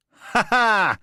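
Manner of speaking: high, loud joyful laugh; hearty laugh. Acted. Alistair lifting Callum in a hug, a loud joyful laugh. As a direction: laugh out big and happy.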